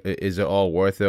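ordinary delivery

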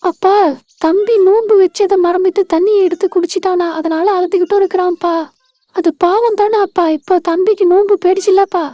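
a concerned big-sister voice, fuller and firmer. Acted. Sister asking her crying brother why, concerned big-sister voice. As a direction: call out to him like an older sister.

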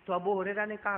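ordinary talk, nothing marked

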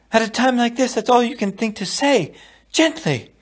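a hurt bride mocking the word; indignant echo. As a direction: throw his word back at him in disbelief. Buttercup echoing indignantly.

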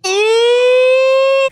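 piercing, very high and loud scream; real shriek. Real, not acted. Gamer shrieking as the monster lunges. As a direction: let out a terrified shriek.

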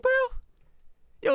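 usual speaking voice

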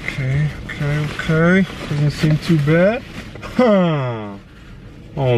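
rising, eager unboxing voice; thrilled, voice climbing up; excited. Real, not acted. Opening eBay package, thrilled.

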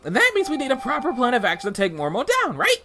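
bouncy little helper creature voice; high sidekick voice. Acted. Sidekick character voice.

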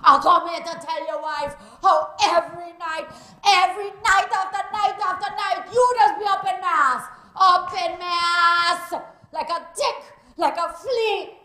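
angry Caribbean woman yelling curses at a man's house; furious cursing woman. Acted. Jilted woman outside Selwyn's house, furious yelling. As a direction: rage at him, loud and raw.

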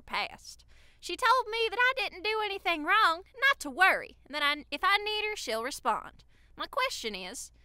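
Southern accent. Shown as a demonstration. Reading a fan's Tinder backstory, hammed-up Southern accent. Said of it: "read it in a Southern accent".

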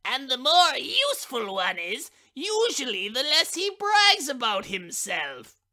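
moralizing matchbox. A narrator's reading. Matchbox giving the moral to the stove lifter, lightly pointed. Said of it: "replied the matchbox".

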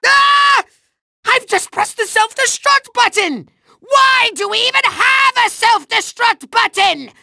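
high, frantic villain yelp; hammed-up panic; panicked alarm. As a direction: wail over your own blunder. Pressed self-destruct by mistake.

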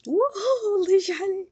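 loud, high burst of delight; thrilled by what appears on screen; excited wow. Real, not acted. Gamer shouting wow at the screen in delight.

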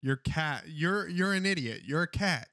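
usual speaking voice

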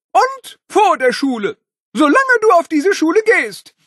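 high loud furious yell; strict teacher. Acted. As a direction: bellow the punishment at the boy. Principal yells detention.

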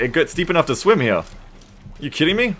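ordinary talk, nothing marked

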